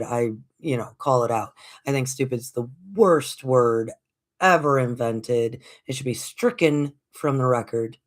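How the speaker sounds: usual speaking voice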